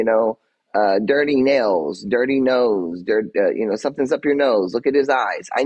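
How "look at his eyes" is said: normal talking voice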